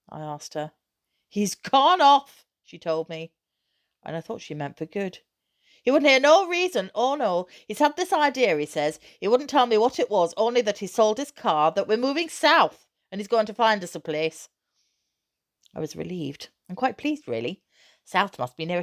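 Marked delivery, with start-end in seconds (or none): 1.3-2.5 high, slow, loud cry of he's gone off; upset mother bursting out to her son; upset outburst. A narrator's reading. Mother distressed telling son. 5.8-14.5 high, loud exasperated rant; mother ranting to son about his father's plan; indignant mother. A narrator's reading.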